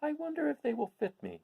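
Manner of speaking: Dorothy, wondering aloud to Toto about the silver shoes. Acted. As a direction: muse aloud to the dog like a curious little girl. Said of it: "she said to Toto".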